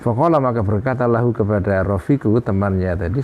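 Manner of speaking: ordinary talk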